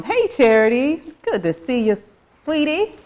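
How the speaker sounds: sweet Southern greeting, pitched high; Southern accent. Real, not acted. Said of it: "That's my southernness".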